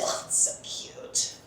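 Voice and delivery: slow, breathed-out mock cooing; mimicked gushing compliment. Cohost mocking Pepe saying cute, mimicked soft gushing. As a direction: mimic him very softly, drawing it out. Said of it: "so tired of Pepe saying cute".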